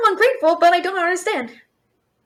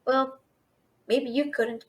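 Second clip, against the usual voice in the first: muttered aside; 4 semitones lower, 8 dB quieter, slower than usual. Acted.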